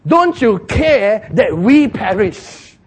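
slow, high, loud drawn-out complaint; wailing reproach. Acted. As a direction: stretch each word in loud dismay. Disciples blame Jesus.

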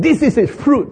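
high, loud presentation; proud spies. Acted. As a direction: hold up the fruit proudly.

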